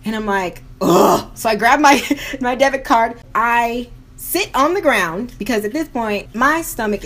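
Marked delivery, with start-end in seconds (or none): 0.8-1.3 loud exasperated ugh; frustrated groan. Retold or imitated. Fed up at the tow place, a loud groan. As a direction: groan it out in disgust.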